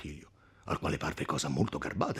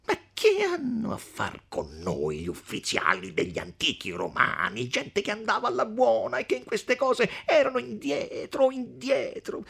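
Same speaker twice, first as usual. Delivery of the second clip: dismissive count; 4 dB louder than usual. Acted. Count dismissing the ancient Romans, dismissive. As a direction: wave the argument away mockingly.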